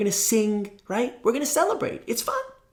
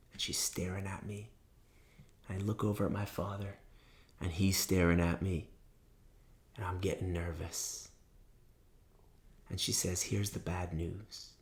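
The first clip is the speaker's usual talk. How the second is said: hushed suspense; 9 semitones lower, 13 dB quieter than usual. Real, not acted. Storyteller building suspense before the judge's bad news, hushed. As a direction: draw the listeners in with a hush.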